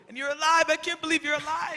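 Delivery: high, loud burst of joyful disbelief; ecstatic. Acted. Peter telling Jesus he's alive, ecstatic cries. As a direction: cry it out in delight.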